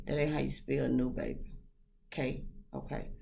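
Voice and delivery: low, very soft trailing mutter; muttered aside. Real, not acted. Vlogger quietly correcting a text's spelling to herself, muttered aside. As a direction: drop right down to a quiet, dismissive aside.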